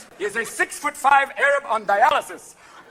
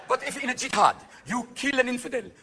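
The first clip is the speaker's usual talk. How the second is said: ordinary talk, nothing marked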